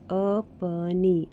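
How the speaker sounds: अपनी said very slowly, syllable by syllable; teacher sounding out अपनी for children. Shown as a demonstration. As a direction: draw out अपनी so each sound is clear. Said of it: "जैसे कि ये".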